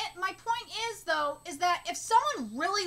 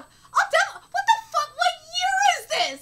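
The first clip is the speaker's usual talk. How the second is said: much higher, louder, with a laugh; laughing outrage; 10 semitones higher, 8 dB louder than usual. Real, not acted. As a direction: shout through a disbelieving laugh. Laughing outrage while reading.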